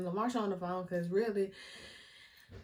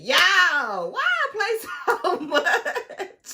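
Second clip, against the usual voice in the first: laughing; 8 semitones higher, 8 dB louder, much slower than usual. Real, not acted. Laughing opener to camera.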